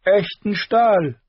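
slow, high, loud; haughty high-pitched demand; princely challenge. Acted. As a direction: draw it out as a dare. Prince demands real swords.

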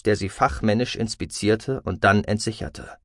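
ordinary delivery

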